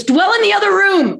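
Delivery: loud high snapping retort; mother's sharp retort. Retold or imitated. Mother dismissing her child to the other room, loud snap. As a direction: throw it back sharp and loud. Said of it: "she goes".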